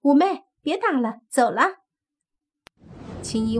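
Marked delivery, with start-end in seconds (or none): 0.0-1.8 high, loud call across the yard; red fox calls her sister away, high and loud. Acted. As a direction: shout to her sister to leave. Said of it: "红衣狐妖便喊".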